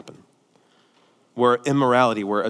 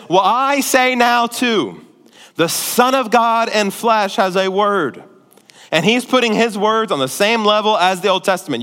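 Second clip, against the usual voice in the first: loud, high-pitched sermon delivery; full-force preaching; 6 semitones higher, 7 dB louder than usual. Real, not acted. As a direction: preach it hard, hammering the key words.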